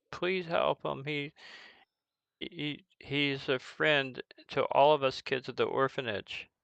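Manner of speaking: hesitant, lightly voiced kid; pleading orphan child. Orphan asking visitors to save his friend, pleading.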